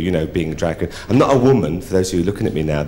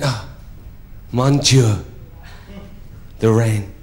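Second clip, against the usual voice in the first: French count marvelling; hammed-up French wonder; French accent; slower than usual. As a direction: exclaim in a French accent, with pauses between phrases. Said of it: "said to me in a French accent".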